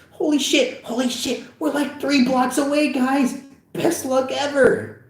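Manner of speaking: a thrilled friend realizing they're close; excited teen boy voice. Acted.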